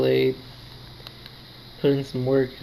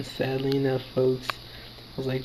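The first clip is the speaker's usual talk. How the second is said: ordinary talk, nothing marked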